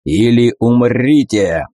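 slow roaring lion voice. Acted. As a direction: roar it furiously like a lion. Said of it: "яростный рык льва".

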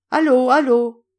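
loud, high hello into a receiver; shouted phone greeting; calling on the phone. A narrator's reading. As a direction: call down the line to be heard. Calling into his talisman phone.

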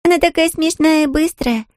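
put-on high, childish voice; giggly little girl. A narrator's reading. Mirra remarks on speedy Anfisa, high giggly little-girl voice. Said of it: "хихикнула в ладошки Мирра".

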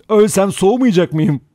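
high, loud, laughing retort; hysterical laughter. A narrator's reading. Vera laughs off being cold, near hysteria. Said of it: "Vera kahkahayı bastı".

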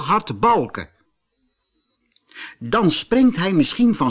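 high, quick cheeky donkey voice; donkey talking. A narrator's reading. Wooden donkey boasting of its plan, high cheeky voice. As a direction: plot a prank gleefully. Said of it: "zei een houten ezeltje".

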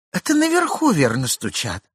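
a high, startled cry from the wife; wife startled by a noise upstairs; frightened wife. A narrator's reading. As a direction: blurt it out, suddenly scared by a noise. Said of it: "заметила жена, испугавшись".

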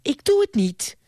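high, curt defiance; curt refusal. A narrator's reading. Niece refusing her uncle, curt and high. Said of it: "zei Ardita kortaf".

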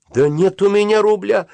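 high, loud, desperate protest; near-tearful cry. A narrator's reading. Penniless boy pleads with his friend, near-tearful cry. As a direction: cry it out as if close to tears. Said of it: "чуть не плача закричал я".

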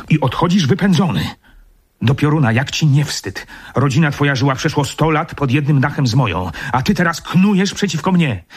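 high-pitched outraged scolding; indignant anger. Acted. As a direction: shame him for his betrayal. Baronet berates his disloyal servant.

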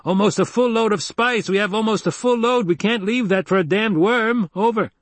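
high-pitched, urgent radio outburst; blasting protest. A narrator's reading. Crew protesting over leaving the spice, blasting radio voice. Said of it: "a voice blasted from the speaker".

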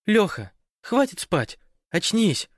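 high, drawn-out call to rouse him; urgent wake-up call. A narrator's reading. Teammate to sleeping Lyokha, calling him to wake up.

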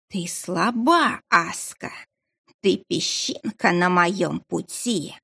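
slow, eerie voice inside her head; a dark presence speaking in the mind; telepathic voice. Acted. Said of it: "Незнакомый голос звучал прямо в голове".

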